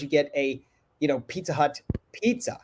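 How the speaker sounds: normal talking voice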